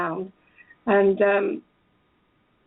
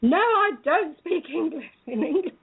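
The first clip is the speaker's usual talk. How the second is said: retold shout; 7 semitones higher than usual. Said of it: "she shouted back at me".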